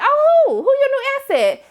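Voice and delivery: high squeal of mock surprise, asking "who". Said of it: "And they was like".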